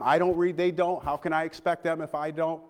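ordinary talk

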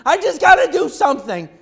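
high, loud overwhelmed outburst; frantic. Retold or imitated. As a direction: blurt it out in a panic to act. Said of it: "so overwhelmed that you just don't know what to do".

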